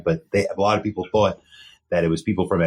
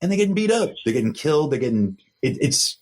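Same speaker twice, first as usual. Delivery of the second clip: normal talking voice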